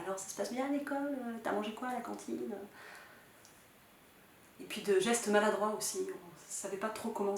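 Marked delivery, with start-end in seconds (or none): 0.0-2.5 higher-pitched, imitated polite small talk; mimicked stilted chit-chat; banal small talk. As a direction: make awkward, trivial conversation in a raised pitch. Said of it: "je me rappelle de phrases banales".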